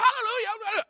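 high loud hallelujah; shouted praise. Retold or imitated. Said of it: "So we're still shouting".

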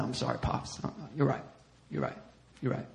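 low, soft submissive voice; meek apology. Retold or imitated. Marcus placating his pastor, meek and quiet. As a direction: give in quietly, contrite. Marcus meekly yields to scolding.

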